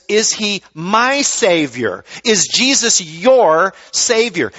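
slow, "my" and "your" pressed hard; slow, personal challenge with "my" and "your" pushed; stress on MY and YOUR. Shown as a demonstration. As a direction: lean on "my", then on "your". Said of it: "that's the personal question".

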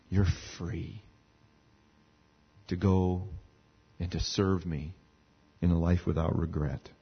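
low, soft, slow reassurance; tender God. Acted. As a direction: speak as God, gently releasing the sinner.

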